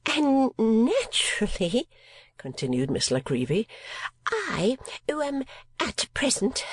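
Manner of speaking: high, affected spinster voice with a prim throat-clear; mincing lady of fifty. Acted. Miss La Creevy pleads to Ralph she cannot lose money, high and mincing. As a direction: play the put-upon unprotected female. Landlady complains about unpaid rent.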